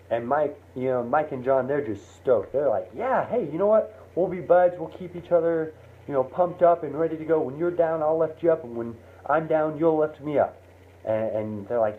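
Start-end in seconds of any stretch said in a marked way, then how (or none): 2.9-10.6 high, bright buddy hype; cheering each other on; pumped up. Retold or imitated. Two friends pumping each other up.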